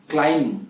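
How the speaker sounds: क्लाइम said with no b at the end; क्लाइम, no final b; silent b in climb. Shown as a demonstration. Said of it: "इसको बोलना है".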